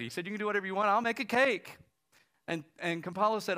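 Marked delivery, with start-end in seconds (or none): 0.3-1.8 loud, high-pitched eager offer; retold enthusiasm. Diner owner offers to help with party, loud enthusiasm. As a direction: act out jumping on board, big and loud.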